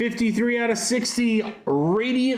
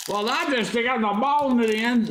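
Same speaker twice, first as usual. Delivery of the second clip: a lightly voiced, higher old football commentator; terrible John Madden; 5 semitones higher, faster than usual. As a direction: toss off a bad play-by-play legend voice. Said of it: "it's a terrible John Madden impression".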